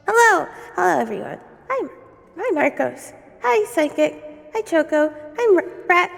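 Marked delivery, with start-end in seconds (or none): none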